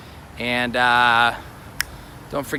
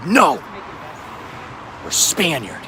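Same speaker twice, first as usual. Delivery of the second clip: imitated angry father; slower than usual. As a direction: burst out as an indignant dad, imitating. Father angrily denies being Italian.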